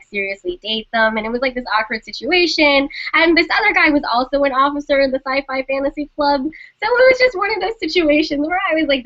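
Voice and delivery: ordinary talk, nothing marked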